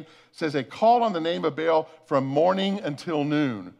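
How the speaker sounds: usual speaking voice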